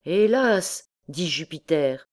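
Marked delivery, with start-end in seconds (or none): 0.0-0.8 higher-pitched woeful exclamation; piteous. A narrator's reading. Actor Jupiter excusing himself to the angry poet, piteous. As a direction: lament it pitifully, voice lifted. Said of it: "répondit piteusement Jupiter".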